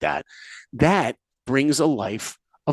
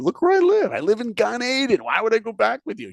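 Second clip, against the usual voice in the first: much higher, excited disbelief; incredulous Simba; 8 semitones higher than usual. Retold or imitated. Simba refusing Nala, high incredulous excitement. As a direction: sell paradise, voice leaping up. Simba boasting about paradise.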